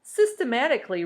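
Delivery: plain reading voice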